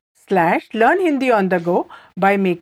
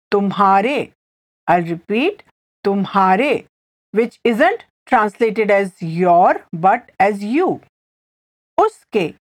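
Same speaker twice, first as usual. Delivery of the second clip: usual speaking voice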